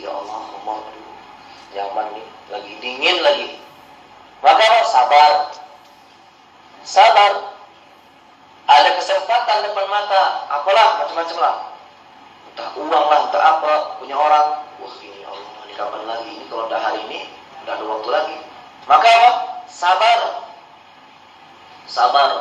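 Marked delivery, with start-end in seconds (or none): 0.0-3.5 softer, low voice of a man tempted by drink; a lured man whispering to himself about the liquor; hushed tempted murmur. Retold or imitated. 14.8-18.4 much softer, lower, eyeing money that isn't his; a preacher voicing a tempted man, hushed; hushed greedy murmur.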